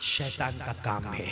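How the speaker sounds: low, slow, soft drop; hushed pronouncement. Real, not acted. Preacher giving congregation the verdict on intoxication, hushed. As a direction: let it fall gravely after the cry. Preacher answers own high cry.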